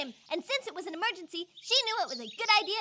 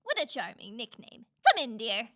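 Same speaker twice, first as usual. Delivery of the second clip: wolf calling out; 7 semitones lower than usual. A narrator's reading. Wolf in Grandma's bed luring Red in, low voice. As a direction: lower wolf voice posing as Grandma. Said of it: "the wolf called".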